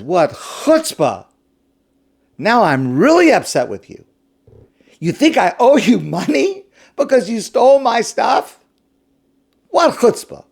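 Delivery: high, loud, indignant outburst; outraged merchant. Acted. Merchant furious at the thief's demand for payment. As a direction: explode at the nerve of it.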